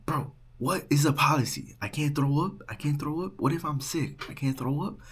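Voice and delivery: mock protest in rising, disbelieving questions; indignant protest. Drunk student challenging the RA over policy, indignant.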